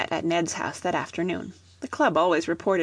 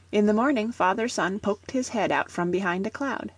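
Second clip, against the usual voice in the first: ordinary delivery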